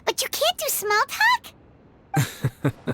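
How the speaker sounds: can't believe what she's hearing; incredulous. Acted. Paimon teasing the commissioner in slow disbelief.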